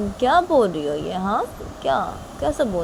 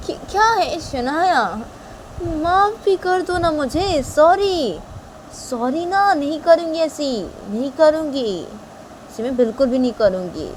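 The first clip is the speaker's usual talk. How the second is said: high, drawn-out begging; high-pitched apologetic coaxing; pleading Tisha; 9 semitones higher, slower than usual. Acted. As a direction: wheedle for forgiveness, pitch raised. Tisha begs forgiveness.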